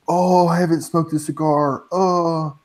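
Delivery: a light put-on voice of a friend; impression of a friend. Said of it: "Who am I?".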